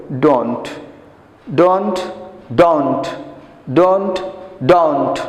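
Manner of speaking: ডন্ট with a round open o, lips rounded; slow teacher demo of the rounded o in ডন্ট; rounded o sound. Shown as a demonstration. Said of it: "মুখটা গোল হবে".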